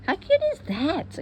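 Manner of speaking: high, gushing delight; thrilled at her own flower; delighted squeal. Real, not acted. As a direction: squeal over how cute it is.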